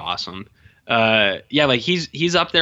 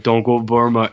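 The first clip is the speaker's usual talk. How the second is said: Rambo; 5 dB louder than usual. Retold or imitated. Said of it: "it's John Rambo".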